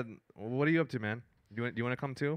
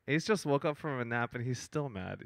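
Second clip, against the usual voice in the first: ordinary delivery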